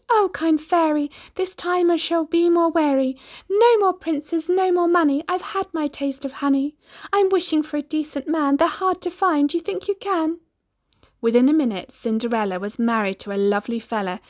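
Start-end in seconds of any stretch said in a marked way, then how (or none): 0.1-10.4 put-on high, earnest girlish voice; Cindy's voice. Cindy answering the fairy, high and earnest. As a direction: plead sincerely for a decent man. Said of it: "Cindy answered".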